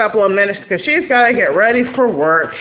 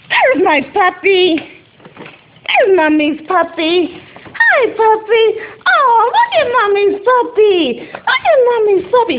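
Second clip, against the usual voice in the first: high cooing pet voice; 10 semitones higher than usual. Real, not acted. Mom greeting her dog, high cooing baby talk.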